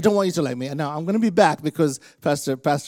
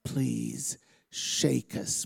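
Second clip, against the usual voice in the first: prayer. Real, not acted. Preacher asking God to speak through him, earnest prayer. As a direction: pray earnestly, phrase by phrase.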